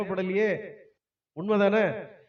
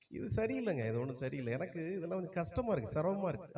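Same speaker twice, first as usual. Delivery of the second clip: softer whiny grumbling; whining complaint; 3 semitones lower, 9 dB quieter, faster than usual. Retold or imitated. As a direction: grumble softly that the job is too hard. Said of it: "அப்படிங்கிறான்".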